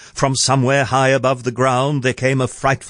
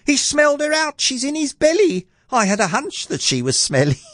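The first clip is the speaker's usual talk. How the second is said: very high, shocked lad tipping into glee; boy crying out. A narrator's reading. Jack realising the giant ate his mum, boy crying out. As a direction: squeal the news in a piping voice. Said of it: "Jack cried".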